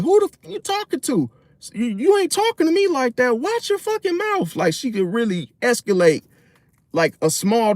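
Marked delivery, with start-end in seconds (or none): 0.0-4.5 much higher, indignant put-on woman's voice; mock angry girlfriend. Girlfriend going off at her man over an insult, high mock outrage. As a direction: play her going off, pitched way up. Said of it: "she definitely would be like".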